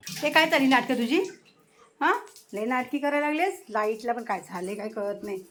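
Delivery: ordinary talk, nothing marked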